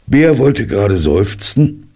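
ordinary delivery, nothing marked

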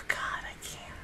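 sighed, barely audible whisper; overwhelmed whisper. Real, not acted. Viewer who can't take the episode, overwhelmed whisper. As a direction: let it out as a weak, sighing whisper.

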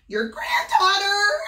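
put-on high, loud squeak faking a little girl; squeaky fake voice. Wolf squeaking to Granny pretending to be Little Red. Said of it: "I squeaked".

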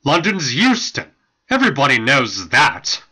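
loud, dismissive engine voice; scornful snorting. A narrator's reading. Engine correcting another about London, loudly scornful. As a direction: snort it out loudly as obvious fact. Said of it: "snorted the engine".

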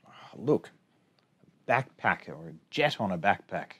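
usual speaking voice

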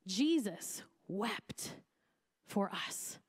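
very slow, each word given room; solemn, weighty. Real, not acted. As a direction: let the two words land slowly and reverently. Powerful verse said with full weight.

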